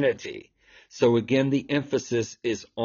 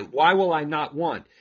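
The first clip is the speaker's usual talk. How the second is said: ordinary talk, nothing marked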